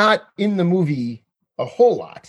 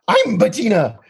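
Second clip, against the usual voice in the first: imitating a funny puppet voice, higher; hammed-up little pig-girl squeal, higher pitched; high-pitched tiger-ish voice; 4 semitones higher than usual. Fan quoting a favourite movie moment, funny high-pitched voice. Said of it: "high-pitched, funny, funny, you know, rugby tiger-ish voice".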